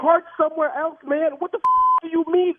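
furious car owner yelling at the manager; angry outburst. Real, not acted. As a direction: demand answers about the stolen car. Prank victim yelling at manager.

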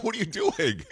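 high-pitched, half-laughing disbelief; mock bafflement. American viewer baffled by Thomas, mock high-pitched disbelief. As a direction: squeal it softly in put-on confusion.

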